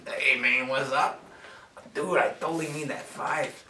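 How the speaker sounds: lightly voiced laid-back dude; a hint of a mellow surfer dude; El Guapo voice. Teammate at the card table asking for a five, lightly voiced dude. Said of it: "El Guapo".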